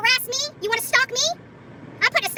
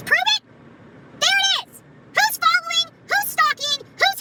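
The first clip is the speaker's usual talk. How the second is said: sarcastic mockery; 8 semitones higher, slower than usual. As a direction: parody his dare as if it were ridiculous. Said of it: "You literally told me to prove it".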